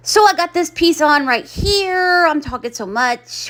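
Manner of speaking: breathless, gasping between words; out of breath. Real, not acted. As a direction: talk while winded. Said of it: "out of breath".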